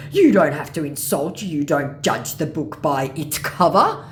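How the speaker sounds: loud, slow scammer voice, rising end; reproachful mock scammer. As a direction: scold as the offended scammer.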